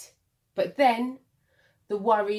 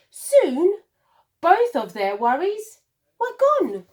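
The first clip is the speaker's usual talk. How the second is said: high, louder joyful burst; joyful climax; 7 semitones higher, 6 dB louder than usual. A narrator's reading. Narrator to children, worries vanishing, in a high joyful burst.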